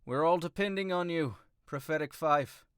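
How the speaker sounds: high-pitched kingly send-off; Cillian. Acted. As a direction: address the party in a lifted voice. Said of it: "and he says".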